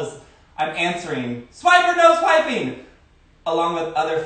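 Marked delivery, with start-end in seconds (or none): 1.5-2.8 high, loud cartoon kid voice; chirpy cartoon explorer asking the audience; Dora the Explorer voice. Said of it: "I feel like Dora the Explorer".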